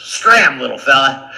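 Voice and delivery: loud lion brushing him off; gruff dismissal. Acted. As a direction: shoo him away loudly.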